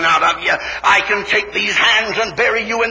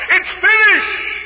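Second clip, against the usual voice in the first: ringing proclamation; 12 semitones higher, slower than usual. Acted. The birds telling the clouds, in a slow high shout. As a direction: proclaim it to the heavens.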